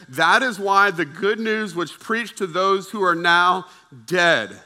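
loud, slow, weighty proclamation; forceful preaching. Real, not acted. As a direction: declare it to the whole room, stretching key words.

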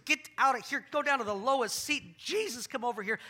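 loud, high rebuke; rebuking shout. Retold or imitated. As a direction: shout the sin down to the lowest seat. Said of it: "he rebukes him".

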